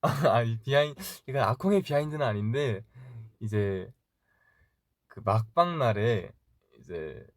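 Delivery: usual speaking voice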